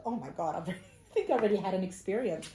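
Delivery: laughing through a loud oh my God; half-laughing at her own shock; giddy laugh while telling it; laughing disbelief. Retold or imitated. Retelling her shock at the course.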